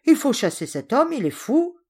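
indignant servant. Acted. Servant demands he be thrown out.

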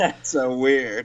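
slow words through laughter; amused, drawn-out reaction; laughing reaction. Real, not acted. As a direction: say it while chuckling in disbelief. Listener laughs at odd story.

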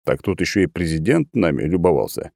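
ordinary delivery, nothing marked

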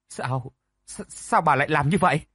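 stumbling, faltering start then pushing the question out; stammering. Acted. Lady Lai stammers at the madwoman, shocked and hesitant. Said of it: "ấp úng nói".

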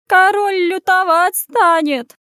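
high, loud, slow lament; keening village wail; wailing peasant woman. A narrator's reading. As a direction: cry out in drawn-out alarm. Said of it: "заголосила баба".

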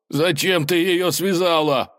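high, drawn-out wail of protest; high-pitched slow cry of complaint. Acted. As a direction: shout it high and slow, aghast. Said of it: "кричал он".

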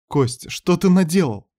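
high, anguished question; alarmed reproach. Acted. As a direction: cry it out high in dismay. Ната reproaches Костя in alarm.

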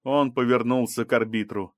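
ordinary delivery

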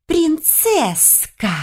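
high, long-drawn menacing taunt; hissing elf. A narrator's reading. Elf on crutches chasing her cornered prey, hissing taunt. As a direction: stretch the words out high, like a hunter taunting prey. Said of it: "зашипела эльфийка".

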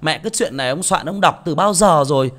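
mock a fed-up listener sneering at a re-read story; scoffing grumble. MC mocks a listener who scorns re-reads, loud scornful whine. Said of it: "Các bạn đừng nghĩ rằng là".

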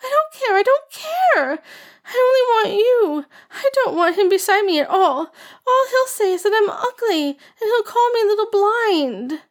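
high, loud whining tantrum; childish tantrum. A narrator's reading. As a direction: stamp and whine like a spoilt child. Girl throws a tantrum.

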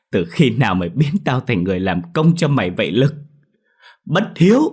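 indignation rising to a high shout; angry rebuke. A narrator's reading. Mother denouncing her ungrateful son, angry rising cry. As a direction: build up and burst out on the last words.

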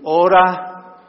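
loud, blunt one-word reply; pastor's curt advice; curt answer. Acted. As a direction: snap back a short answer.

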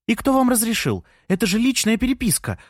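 very high, indignant exclamation; scandalised, high-voiced outburst; shocked gasp. A narrator's reading. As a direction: protest aghast, pitch shooting up. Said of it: "ахнула Джанна".

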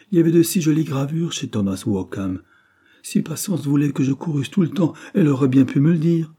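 tearful. Acted. Anna sobbing, impertinent.

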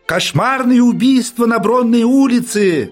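street hawker cry. Acted. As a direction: howl the headline to the crowd. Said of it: "завывали неестественные сиплые голоса".

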